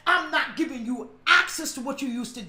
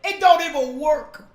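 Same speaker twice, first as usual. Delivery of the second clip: louder punch; 9 dB louder than usual. Shown as a demonstration. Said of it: "repeated slower".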